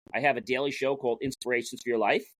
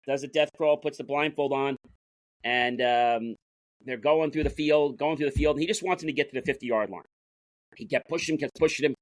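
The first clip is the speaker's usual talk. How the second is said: normal talking voice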